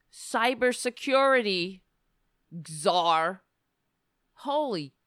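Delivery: ordinary talk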